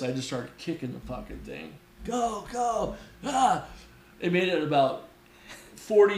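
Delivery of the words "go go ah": high, frustrated retold yelp; mock exasperated urging, pitched up; pissed off urging. Rider kicking a stubborn horse, pissed off urging. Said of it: "I was so pissed".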